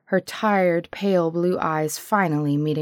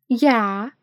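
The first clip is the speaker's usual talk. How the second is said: put-on young girl voice; 5 semitones higher than usual. A narrator's reading.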